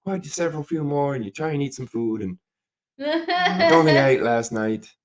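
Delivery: hint of a hungover old builder; old Irish builder. Builder recounting his big night at the pub, lightly imitated old Irishman. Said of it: "he's like".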